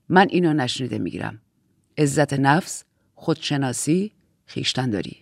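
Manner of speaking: low, controlled reprimand; low voice, spacing out each virtue; stern admonishing aunt. Acted. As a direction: admonish quietly from authority, pitched well down. Aunt admonishes Jade for swearing.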